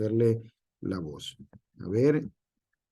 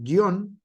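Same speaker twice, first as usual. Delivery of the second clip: guión with the final O punched higher; stress on final O; 7 semitones higher, 4 dB louder than usual. Shown as a demonstration. Teacher showing where the word sounds strong, guión stressed on O. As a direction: hit the last syllable of guión, pitched up. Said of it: "aunque suena fuerte en la pa".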